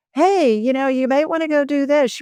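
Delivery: high-pitched, tentative nudge; nudging spirit. Acted. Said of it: "knocking on the door for a long time going".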